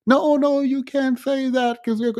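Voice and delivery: hammed-up scandalized churchgoer; mock scolding objector. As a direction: imitate a shocked scold cutting her off. Imitating people scolding her.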